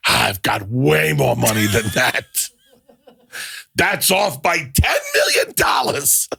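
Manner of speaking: mock-outraged comic screech, building; Gilbert Gottfried impression. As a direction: stretch every word in loudmouthed comic protest. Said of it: "And Gilbert Godfrey goes".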